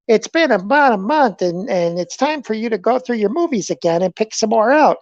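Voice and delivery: mock brother nagging about the movies; put-on brother voice. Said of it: "I talk like that and make him talk like that".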